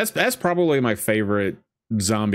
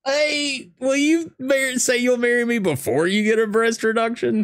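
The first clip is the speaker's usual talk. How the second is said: laughing. Real, not acted. Mocking movie character, laughing.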